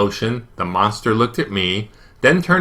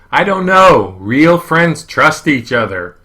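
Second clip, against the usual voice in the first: yelling across the level; 6 semitones higher, 6 dB louder than usual. A narrator's reading. Boy yelling over the giant monster to his friend, loud shout. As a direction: yell it so he hears you on the other side. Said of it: "so I just started yelling at the monster's belly".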